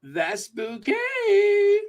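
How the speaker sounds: slow, soaring high singsong; hammed-up posh correction; Hyacinth Bucket. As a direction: draw out the last syllable grandly and very high. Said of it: "Every so often I start to channel".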